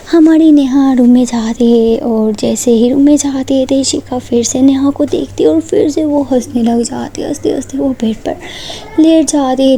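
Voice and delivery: ordinary delivery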